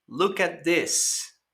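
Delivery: slow, careful "Look at this"; slow teacher model; slow model phrase. Shown as a demonstration. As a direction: say it slowly for students to copy.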